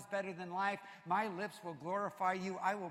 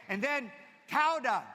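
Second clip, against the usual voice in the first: Tauda said loud and high, falling; Tauda emphasized; 5 semitones higher, 10 dB louder than usual. Shown as a demonstration. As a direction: land on the word Tauda. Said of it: "names the Hebrew word".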